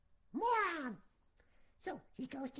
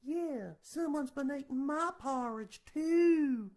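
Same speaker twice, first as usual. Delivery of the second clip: louder, slow mama bear agreeing; 11 dB louder, slower than usual. Acted. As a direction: lean into it, slower and louder. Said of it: "And Mama Bear's like".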